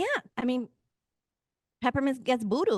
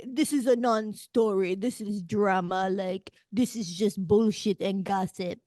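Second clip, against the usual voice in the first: a mock Ukrainian accent, slow and deliberate. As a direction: put on a playful Ukrainian accent to quote him. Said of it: "that was my best Ukrainian accent".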